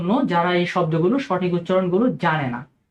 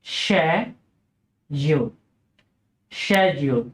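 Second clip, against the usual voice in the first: British schedule, slow SHED-yool; British pronunciation; 4 semitones lower, much slower than usual. Shown as a demonstration. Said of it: "British উচ্চারণটি হচ্ছে".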